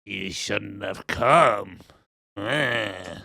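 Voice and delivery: very slow, low, eerie crone; put-on spooky hag voice; creepy woman. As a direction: draw out an ominous warning. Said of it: "Instead, she just goes".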